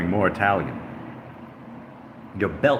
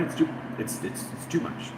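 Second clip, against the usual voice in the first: hushed; 6 semitones higher, 8 dB quieter than usual. Acted. Dramatic hushed lament.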